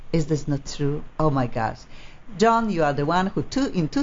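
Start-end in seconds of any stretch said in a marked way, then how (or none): none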